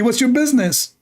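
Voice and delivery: put-on high, indignant Saudi accent. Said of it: "in Saudi accent".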